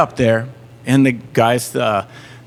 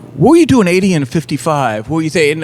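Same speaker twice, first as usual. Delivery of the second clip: a louder, higher stern judge; 4 semitones higher, 6 dB louder than usual. Retold or imitated. Judge grilling the speeding kid, stern and loud. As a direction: bark the question down from the bench. Judge voiced in retold story.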